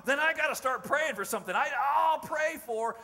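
loud, high, acted enthusiasm; eager excitement. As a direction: launch eagerly into a new plan. Acted reply of excited Bob.

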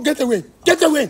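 angry shout. Real, not acted. Furious father chasing prankster off.

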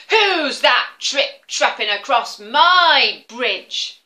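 loud, slow booming troll. Acted. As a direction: boom it out like a troll. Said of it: "out jumped a troll onto the bridge and said".

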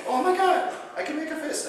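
retold patient's astonished cry; amazed. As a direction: sound stunned and thrilled. Patient amazed he can grip.

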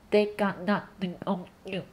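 blocked-nose They got nothing on you; nose pinched. Shown as a demonstration. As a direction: say it holding your nose shut. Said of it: "我现在捏住我的鼻子". (捏着鼻子读)

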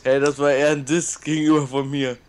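usual speaking voice

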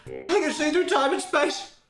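very high, dazed voice; a giddy, spaced-out cry; delirious. Real, not acted. Delirious from the chili heat.

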